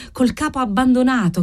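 usual speaking voice

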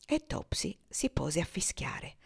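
usual speaking voice

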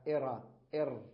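إر with a short i vowel before R; sounding out. Shown as a demonstration. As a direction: sound out إر twice, clipped. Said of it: "بدل أن يقول". (نطق إر بكسرة قصيرة قبل الراء)

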